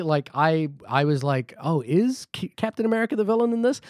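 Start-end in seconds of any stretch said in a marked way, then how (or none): 1.6-3.8 surprised. Retold or imitated. Retelling own surprised reaction.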